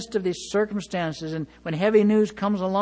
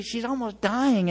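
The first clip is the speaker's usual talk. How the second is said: normal talking voice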